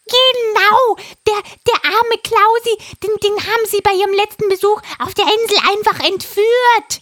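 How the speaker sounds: high, loud parrot voice; a frantic parrot urging; excited parrot. Acted. Parrot voice held. (hohe, aufgeregte Papageienstimme)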